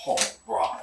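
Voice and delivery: ordinary talk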